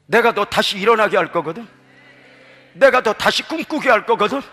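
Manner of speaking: hushed message, gradually building; tender voice of God. Acted. Voices God speaking to listeners.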